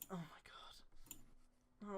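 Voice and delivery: slow, breathless whispered disbelief; stunned slow whisper. Real, not acted. Stunned reaction to fan fiction.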